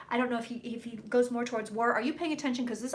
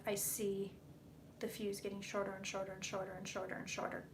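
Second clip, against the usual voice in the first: hushed; 3 semitones lower, 10 dB quieter than usual. Real, not acted. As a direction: quietly describe the vision. Hushed vision description.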